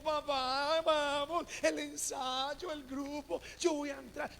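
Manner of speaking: a hint of an eager boy; pleading teen son. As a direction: beg your dad to go to rehearsal. Teen son pleads with his father.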